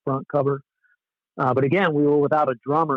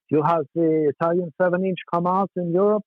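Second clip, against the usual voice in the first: imitated German promoter, pitched up; German accent; 4 semitones higher than usual. German caller praising the band's record, mock German accent. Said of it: "this German-sounding guy".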